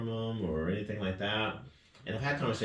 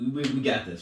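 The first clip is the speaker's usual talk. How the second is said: lifted, louder rallying; rallying pep talk; 7 semitones higher, 7 dB louder than usual. Retold or imitated. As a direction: fire up your athlete. Coach rallying athlete after failure.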